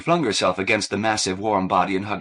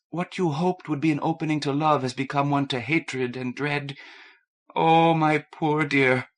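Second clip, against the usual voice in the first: tender pity; 6 semitones higher than usual. Acted. Kathleen comforting Fidelio.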